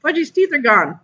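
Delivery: put-on high alarmed girl; high frightened cry; screamed. A narrator's reading. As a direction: shriek in dismay. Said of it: "Sheila screamed".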